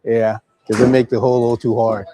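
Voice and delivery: ordinary talk, nothing marked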